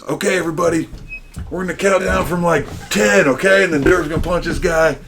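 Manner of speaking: high, loud hyped-up emcee; announcer. Retold or imitated. Announcer rallying the crowd before the onstage punch, loud announcer voice. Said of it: "Jeff, the announcer, goes".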